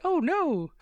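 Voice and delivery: high mock scream of a cartoon princess. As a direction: shriek it like a panicked princess. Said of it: "I just remember a lot of screaming".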